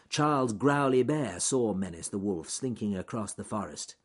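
ordinary delivery, nothing marked

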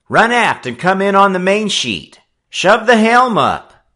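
high, loud barked command; ordering. A narrator's reading. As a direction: snap out the order to the crew. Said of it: "Frisco Kid ordered".

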